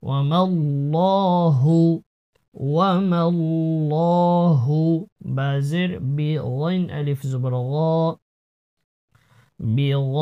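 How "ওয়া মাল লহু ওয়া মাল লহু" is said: লহু with a thick l and rounded long vowel; heavy lam. Shown as a demonstration. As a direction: recite ওয়া মাল লহু with a full, heavy double l. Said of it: "মোটা পড়তে হবে".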